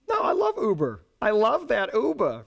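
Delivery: high, indignant mother-in-law protest. Retold or imitated. Mother-in-law angry that regulators ban Uber, high and indignant. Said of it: "that's my mother-in-law voice".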